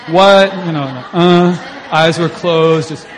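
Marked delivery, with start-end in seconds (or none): 0.1-0.5 much higher and louder, baffled; loud puzzled what. Retold or imitated. Teller imitating a girl's baffled reaction, loud and high. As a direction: blurt it out, totally confused.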